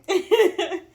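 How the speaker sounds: loud high burst of laughter; big laugh. Real, not acted. Host laughing at the daughter's remark, loud and high. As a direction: let a real laugh burst out.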